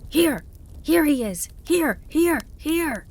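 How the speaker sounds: high, loud calls falling off each time; excited shouting. A narrator's reading. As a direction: shout repeatedly to someone a long way away. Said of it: "he shouted".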